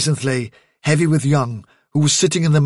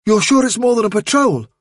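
anxious checking; 8 semitones higher, 3 dB louder than usual. Acted. Doubting Holly's alarming report.

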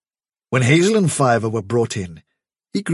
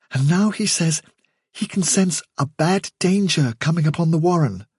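higher-voiced, deferential young rabbit; hesitant young rabbit; 9 semitones higher than usual. A narrator's reading. Hazel explaining his brother's gift to the chief, hesitant. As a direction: explain tentatively, pitched well up. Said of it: "rather hesitantly".

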